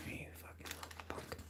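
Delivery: hushed playful whisper. Real, not acted. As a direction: whisper the insult fondly. Whispering at the sticky hash.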